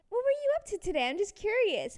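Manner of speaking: high, falsely casual mom voice; fishing mom. Retold or imitated. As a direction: play mom prying while feigning innocence. Imitates mom fishing for information.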